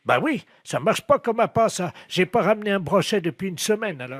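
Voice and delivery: high-voiced angler bemoaning his catch; blithely unconcerned fisherman. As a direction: reassure her in a high, oblivious voice. Fisherman character on prank call.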